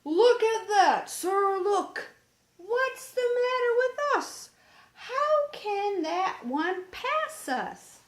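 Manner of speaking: high, loud, upset cry of frustration; angry boy near tears. A narrator's reading. Johnny crying out to the captain as a ship passes, upset. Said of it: "Johnny was so angry he almost wept".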